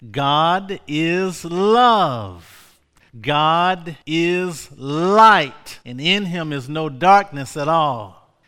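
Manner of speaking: slow, swelling pulpit declaration; preacher starting to lift; rising preaching. Acted. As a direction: draw out each word as the sermon builds. Sermon building up.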